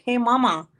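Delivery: put-on playful country-boy greeting; little country boy. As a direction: say it like a small country kid. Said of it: "he's like a little country boy".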